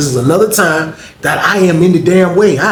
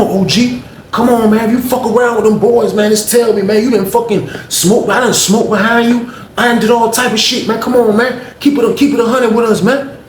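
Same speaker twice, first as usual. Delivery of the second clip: higher earnest plea; young boy pleading; 7 semitones higher than usual. Retold or imitated. Young boy pleading with the OG, pitched up. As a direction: plead with him to be straight.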